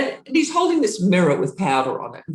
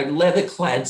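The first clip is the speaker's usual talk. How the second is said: usual speaking voice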